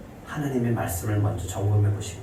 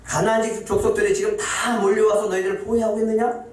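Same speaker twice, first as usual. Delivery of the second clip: challenging question; 9 semitones higher than usual. Acted. God's challenging question voiced.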